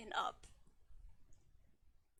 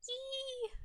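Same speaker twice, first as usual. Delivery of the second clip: high squeal; 11 semitones higher, 4 dB quieter than usual. Real, not acted. Playful squeal.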